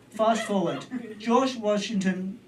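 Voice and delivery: normal talking voice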